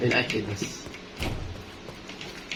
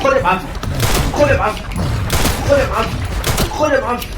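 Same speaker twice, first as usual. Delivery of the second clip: louder, rising jeers with each shot; taunting shouts; 6 semitones higher, 5 dB louder than usual. Real, not acted. Gamer firing at the monster, shouting taunts.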